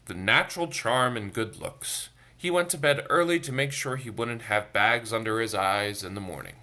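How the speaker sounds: plain reading voice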